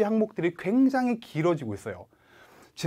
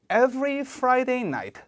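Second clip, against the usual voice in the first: "Every Friday night" every word hit hard, slow; slow model line with full stress; all stressed; 7 semitones higher, much slower than usual. Shown as a demonstration. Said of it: "every Friday night 다 세게 읽어주실게요".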